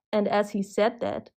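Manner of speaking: ordinary delivery, nothing marked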